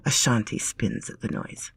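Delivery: ordinary delivery, nothing marked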